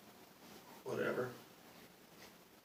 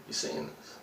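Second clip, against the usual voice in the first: You seeing this whispered, softer and lower; whispered repeat; 5 semitones lower, 5 dB quieter than usual. Retold or imitated. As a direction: lean in and whisper the question again. Asks coworker again, hushed.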